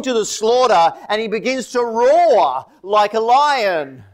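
high, surging sermon voice; impassioned preaching. Real, not acted. Preacher on Jesus roaring like a lion, impassioned. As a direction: preach it with mounting fervour.

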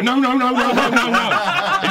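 high, loud, stretched-out chorus of no; lads shouting no. Retold or imitated. Lads in the pub begging him not to. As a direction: wail the noes like a pub crowd.

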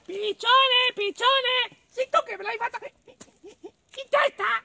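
very high, loud squeaky mole; little mole. Acted. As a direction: squeal the calls, then stutter the accusation. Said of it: "E così andò dal piccione".

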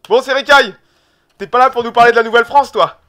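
appel fort et plus aigu, débit rapide; héler son invité pour lancer l'émission; interpellation enjouée. Real, not acted. (appel joyeux et sonore à Serekai)

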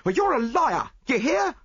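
loud, high, combative maid voice; angry chambermaid. A narrator's reading. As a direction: jab it at her like a fight. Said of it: "said the chambermaid, advancing upon her".

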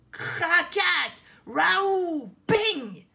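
high, very loud crash noises; imitated crashing din. A narrator's reading. Narrator making the noise of a trap, crashing. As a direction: burst out with explosive bangs. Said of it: "Un vacarme énorme vient de retentir".

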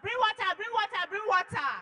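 high, loud urgent command; retold urgent shout. Husband demanding water for the injured woman, shouting. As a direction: bark for water in a panic. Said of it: "He was shouting".

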